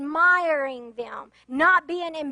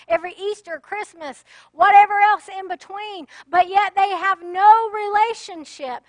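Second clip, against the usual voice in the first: raised, urgent preacher's call; fervent preaching; 4 semitones higher, 6 dB louder than usual. Real, not acted. Preacher warning the congregation, at full force. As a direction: preach it hard to the pews.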